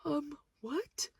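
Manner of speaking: very high, small questioning voice; baffled. Retold or imitated. Cashier stunned by customer's praise, baffled. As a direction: squeak it out in confused disbelief.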